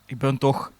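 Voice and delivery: ordinary delivery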